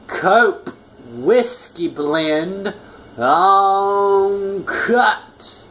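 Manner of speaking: drawn-out grand reveal; announcer voice. Real, not acted.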